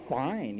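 FINE pushed out on a leaning F; mock-emphatic FINE with a falling end; emphasized first syllable. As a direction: imitate them, stressing the start of FINE. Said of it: "they emphasize the first syllable".

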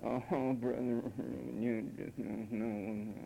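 soft, low, dragging whine; put-on pathetic bleating; mock whining. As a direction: moan it slowly like a feeble complainer. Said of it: "or whiningly".

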